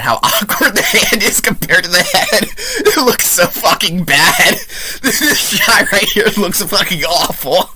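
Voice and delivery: laughing through the words, high and slow. Real, not acted. Reviewer laughs at an awful hand shot, laughing. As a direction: crack up while mocking the shot. Laughing at bad special effect.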